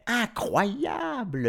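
high, long drawn-out exclamation; amazed delight. A narrator's reading. Madame Popoff jumping up and down, amazed. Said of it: "répondit Madame Popoff en sautant sur place".

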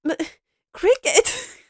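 very high, slow, incredulous squeak with a laugh; childish disbelief. Retold or imitated. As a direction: stretch it out like a shocked boy. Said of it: "Robert's like".

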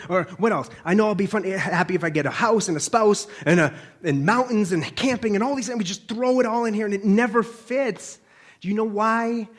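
ordinary delivery

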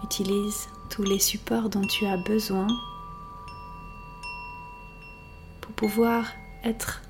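unhurried relaxation voice, letting silence settle; guided meditation. Acted. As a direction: guide the listener gently into rest, phrase by phrase. Leads a yoga nidra relaxation.

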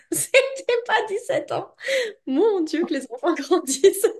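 laughing, high and amused; giggling through the words. Real, not acted. Amused at the daughter's age. (en riant, voix aiguë)

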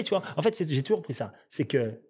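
ordinary delivery

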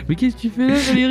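surprised. Pretend. Calls out to the creature.

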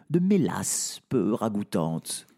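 ordinary delivery, nothing marked

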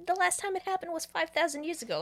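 high, quick indignant retort; exasperated protest. Retold or imitated. Retold priest protesting, high and fast.

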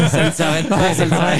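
laughing. Real, not acted. Laughing at gift pile.

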